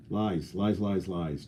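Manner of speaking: lies trailing off softly; fading whisper of lies. Pretend. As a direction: let the lies fade away. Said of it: "repeated softer".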